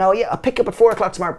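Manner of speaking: high, rushed imitation of an eager lad; mock eager chat-up. Young lad arranging a market date, mock-eager.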